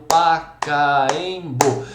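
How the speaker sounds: Pacaembu slowly chopped into Pa-ca-em-bu; syllable by syllable. Shown as a demonstration. Teacher clapping out syllables for students, split syllable by syllable. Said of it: "em alguns casos é bater palmas".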